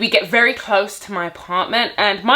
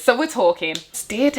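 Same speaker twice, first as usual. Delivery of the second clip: normal talking voice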